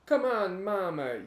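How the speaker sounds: hammed-up Italian calling out mama; Italian accent. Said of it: "when they're like".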